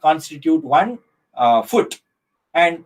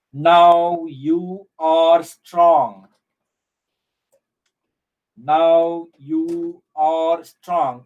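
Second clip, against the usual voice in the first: very slow, drawn-out poem reading, word by word; counting syllables; much slower than usual. Shown as a demonstration. Teacher reading a poem line to students to count syllables, slow and measured. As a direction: stretch each syllable out long.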